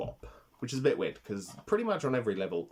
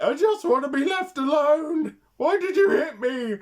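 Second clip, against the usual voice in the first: high, loud, put-upon wail; a much higher, louder aggrieved whine; whiny bear suit guy; 9 dB louder than usual. Retold or imitated. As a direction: squeal like a hurt man in a costume. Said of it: "a guy in a bear suit who's like".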